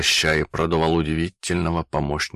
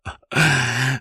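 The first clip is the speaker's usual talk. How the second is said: high villainous cackle; sinister laugh; 9 semitones higher than usual. Acted. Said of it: "Зловеще засмеялся Химиков". (зловещий смех)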